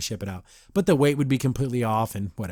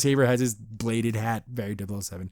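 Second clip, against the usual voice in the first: normal talking voice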